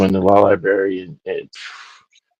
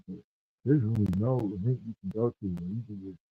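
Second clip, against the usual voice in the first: muffled, like through a wall; dull, muffled mic sound; 9 dB quieter than usual. Real, not acted. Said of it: "you sound muffled".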